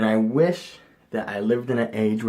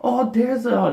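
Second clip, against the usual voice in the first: high, excited start; eager spotting; mock excitement; 12 semitones higher, 4 dB louder than usual. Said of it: "I'm like".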